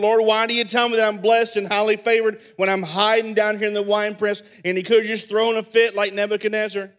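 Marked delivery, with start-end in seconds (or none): 0.0-4.4 loud and high, upset; an aggrieved protest to God; mad complaint. Pretend. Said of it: "could have got really mad and upset and said".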